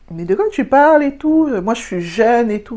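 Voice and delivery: high indignant protest, half-hearted; mock indignant old man. Old man protests to narrator, mock indignant. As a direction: act offended at being called old. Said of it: "Après, il fait".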